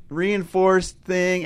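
ordinary delivery

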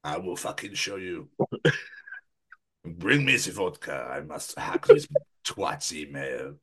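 put-on low, slow comic Russian accent. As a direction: plot menacingly in a deep Russian accent. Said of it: "taking the piss out of Russians".

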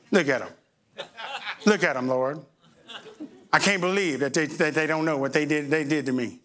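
low, smug side comment; catty low aside; sly judging. Retold or imitated. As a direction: murmur judgment to God.

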